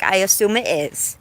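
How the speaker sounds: ordinary talk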